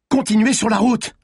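high, loud, urgent command; insistent order. A narrator's reading. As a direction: insist forcefully over the chase. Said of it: "insista Malines".

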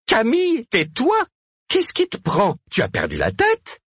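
high-pitched, exasperated protest; alarmed scolding. A narrator's reading. Cosima silencing Camille on stage, alarmed scolding. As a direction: cut in sharply, very high.

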